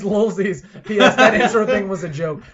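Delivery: loud laughing mock; laughing read.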